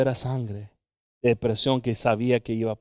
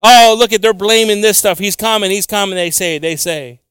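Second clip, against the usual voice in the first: loud, high, slow pulpit preaching; fiery scripture preaching; 9 semitones higher, 11 dB louder, slower than usual. Acted. Pastor reading Second Peter aloud to the congregation, loud and fiery. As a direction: thunder the verse out to the church.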